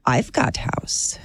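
put-on slow, low Eastern European accent; drawn-out sales pitch in a mock Eastern European accent; fake Eastern European accent. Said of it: "Is this Eastern European?".

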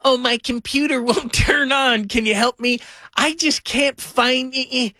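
high, slow mock plea for tech help; imitating helpless parents. Host mocking his parents asking for iPhone help, high and slow. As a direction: play the confused parent begging for help. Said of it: "you sound like, you know, my parents".

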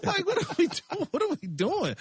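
high-pitched, incredulous laughter in the words; amused exasperation, real laugh breaking in; laughing disbelief. Real, not acted. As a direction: laugh through it, voice shooting up.

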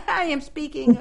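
high, helpless laughing words. Real, not acted. Host introducing her guest, breaking up laughing.

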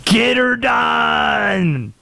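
high, very drawn-out Southern holler; Southern accent catchphrase. Acted. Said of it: "It's always the Southern accent".

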